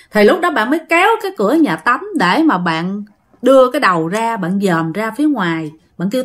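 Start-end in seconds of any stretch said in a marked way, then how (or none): none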